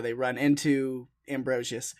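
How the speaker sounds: usual speaking voice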